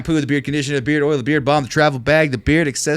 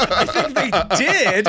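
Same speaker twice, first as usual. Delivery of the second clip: laughing; 10 semitones higher than usual. Real, not acted. Laughing through the words.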